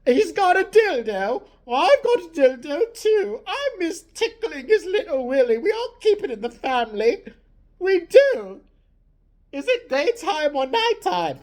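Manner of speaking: hammed-up doddering queen; old queen voice.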